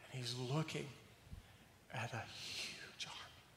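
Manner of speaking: the preacher painting the servant's discovery, hushed; hushed suspense. Shown as a demonstration. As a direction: drop to a near whisper as the army appears. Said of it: "picture it now".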